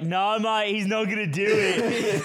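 high, loud Aussie bloke voice, laughing through it; Australian accent. Retold or imitated. Mimicking an Australian zoo worker to the guys, in a hammed-up Australian accent. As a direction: ham up a cheeky Australian zookeeper accent. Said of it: "I wish I could do the accent so bad".

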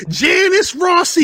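high, drawn-out movie-scene holler; mock outraged shout. Re-enacting the banging wife for co-hosts, mock outraged shout. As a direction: holler the name like an accusing wife.

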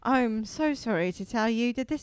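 apologetic mock British judge; gentle British judge. British judge sorry to tell Nancy, mock-gentle. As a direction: break bad news sweetly in a British accent. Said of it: "beautiful, like, group is like".

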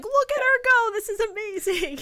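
very high, loud admiring cheer; proud cheering. Retold or imitated. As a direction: cheer her on like a proud fan. Retold cheering inner voice.

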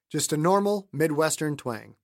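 plain reading voice

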